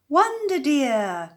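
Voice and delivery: slow, high, loud call of welcome; cried out. A narrator's reading. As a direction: call out warmly to her granddaughter. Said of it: "cried Granny".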